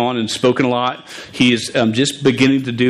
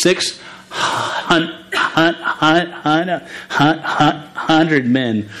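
higher, stuck repeats on the start of hundred; real stutter; 4 semitones higher, much slower than usual. Real, not acted. Stuttering preacher explaining the battalion's size, stuttering hard. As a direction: block on the first syllable over and over, then release it. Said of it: "A st-, stuttering guy".

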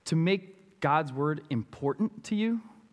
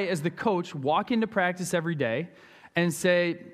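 normal talking voice

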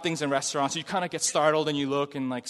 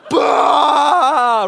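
loud high shouted fire burst; fiery roar; 9 semitones higher, 13 dB louder than usual. Retold or imitated. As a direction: erupt like flames shooting out. Fire bursting from the red guy.

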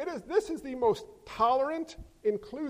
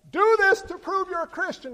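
higher, louder mock demand; mock test; 8 semitones higher, 6 dB louder than usual. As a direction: parody a religious test. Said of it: "So Jesus says".

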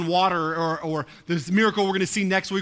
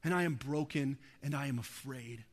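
quiet prayer; 4 semitones lower, 12 dB quieter than usual. Retold or imitated. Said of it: "start crying out to him saying".

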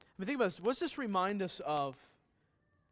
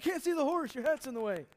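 high-pitched, raised-voice mock complaint; complaining husband; 8 semitones higher, 4 dB louder, faster than usual. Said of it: "He's like".

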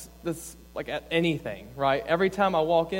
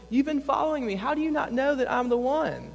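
mock defensive Jesus; 9 semitones higher than usual. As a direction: play a huffy, put-out Jesus for laughs.

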